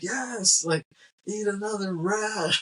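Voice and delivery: high, slow, gleeful cheering; mock excited cheer. [name] cheering the masked man to eat rats, gleeful high cheer. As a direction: egg him on like a thrilled ringmaster.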